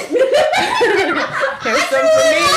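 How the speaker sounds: high laughing squeal, slow. Real, not acted. Pouring drink, laughing squeal.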